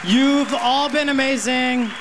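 high-pitched thanks over applause; rousing sign-off. Real, not acted. Comic thanking the audience as the set ends, high rousing sign-off. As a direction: call out your goodbye to a cheering crowd, pitched high.

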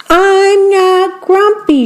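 high, slow grumpy shout; child's stubborn denial, shouted high and slow. A narrator's reading. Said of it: "shouted Jim".

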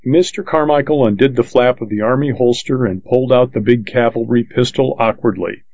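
ordinary delivery

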